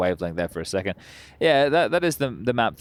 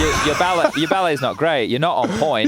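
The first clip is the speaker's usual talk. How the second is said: higher and louder through a laugh; laughing tease; 6 semitones higher, 4 dB louder than usual. Real, not acted. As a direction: roast the player playfully. Joking banter, laughing.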